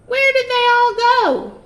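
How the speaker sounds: loud high witch shout. Acted. Witch shouting at a duck. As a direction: yell it across the farm. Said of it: "Gritch shouted to a duck".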